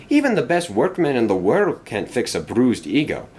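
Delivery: ordinary delivery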